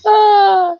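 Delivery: high, loud burst of a laughing cry; delighted squeal. Real, not acted. Squealing with delight at the pie video.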